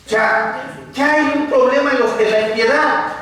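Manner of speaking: ordinary talk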